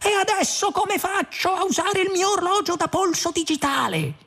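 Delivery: very high, panicked wail; exclaiming. A narrator's reading. Arthur panicking over his digital watch, crying out. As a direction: wail it at the top of the range. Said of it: "esclamò".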